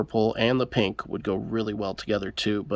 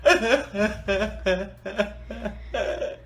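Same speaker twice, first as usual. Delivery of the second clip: pained laugh; 9 semitones higher, 4 dB quieter than usual. Real, not acted. Just sliced finger open.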